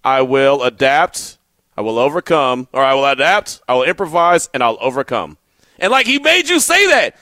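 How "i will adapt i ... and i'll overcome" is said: low, measured teacher's pledge; Mister Cepeda, slow recitation. Retold or imitated. Host imitating his teacher's pledge to adapt and overcome, low and deliberate. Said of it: "he's doing this all slow".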